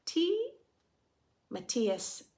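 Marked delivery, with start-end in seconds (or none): 0.1-0.5 Tea said high and rising as a question; a child asking "Tea?" in German; German word. Acted. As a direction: offer "Tea?" like a bright child. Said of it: "asked in German".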